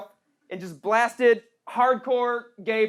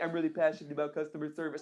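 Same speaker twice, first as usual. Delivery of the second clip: softer, quicker, hollow recited cliché; mock earnest cover letter; 7 semitones lower, 10 dB quieter, faster than usual. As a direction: rattle it off like a canned job application. Reading a cover letter line.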